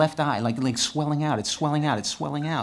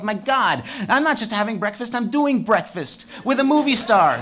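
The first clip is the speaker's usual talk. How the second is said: giddy, laughing, higher and slowing at the end; delighted inner thought; 7 semitones higher, 5 dB louder than usual. Retold or imitated. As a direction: gush to yourself about the movie star.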